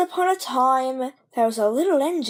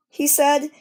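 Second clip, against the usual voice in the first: ordinary delivery, nothing marked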